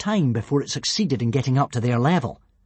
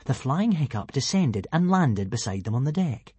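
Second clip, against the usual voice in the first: usual speaking voice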